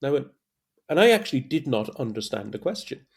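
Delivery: usual speaking voice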